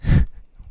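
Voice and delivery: a quick loud breathy laugh; brief amused burst of laughter; short laugh. Real, not acted. Laughs after setting up example.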